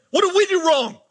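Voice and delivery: very high-pitched imploring question; anguished plea. Retold or imitated. Pastor voices parents asking God what went wrong, pleading. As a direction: cry out in imitated exasperation.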